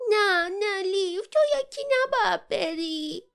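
high, loud, drawn-out tearful plea; pleading. A narrator's reading. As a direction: beg him slowly in a high, wailing character voice. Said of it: "التماس کرد". (التماس گریان با صدای زیر و کشیده)

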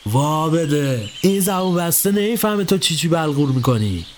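put-on old master brushing off his helper; Ebrahim's voice. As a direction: drag the words out like a scolding master. Ebrahim character voice.

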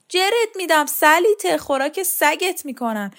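high-pitched mimicked rage, loud at the start; wife acting out her husband's furious threat; threatening husband. Wife quotes husband's violent threat.

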